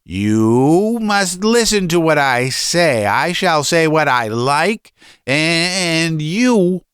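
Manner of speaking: high, emphatic tirade; domineering father ranting at his son; furious father. A narrator's reading. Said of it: "he stamped with his feet".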